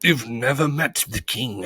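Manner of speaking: high, indignant bee; angry accusation. A narrator's reading. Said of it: "accused the bee angrily".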